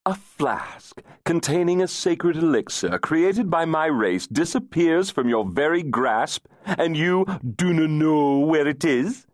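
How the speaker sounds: high, outraged disbelief; incredulous. Acted. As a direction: demand it in disbelief.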